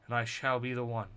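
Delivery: epic storyteller voice, building to proclamation; dramatic narration. A narrator's reading. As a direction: read it like a grand comic narrator.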